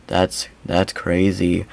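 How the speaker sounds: low, quiet, slow deadpan drone; mocking monotone. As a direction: say it as if nothing could ever surprise you. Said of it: "in that monotone of a voice".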